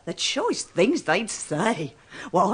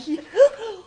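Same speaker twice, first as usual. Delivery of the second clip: high startled gasp with a laugh in it; sharp gasp; 11 semitones higher than usual. Acted. Cleaner gasps on hearing he is police. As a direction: gasp as it dawns on her.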